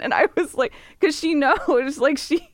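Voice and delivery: ordinary talk, nothing marked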